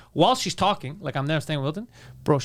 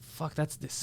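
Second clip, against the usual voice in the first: muttered aside; 13 dB quieter, much slower than usual. Retold or imitated. Muttered retold thought.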